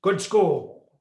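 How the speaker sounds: imitating his status-minded parents; snobby parents. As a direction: quiz him like pushy parents. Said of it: "it was always like".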